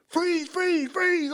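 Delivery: high mock cop shout; repeated high-pitched order; retold police command. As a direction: imitate officers calling out urgently, without full volume. Police running up with guns.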